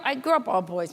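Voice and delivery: ordinary delivery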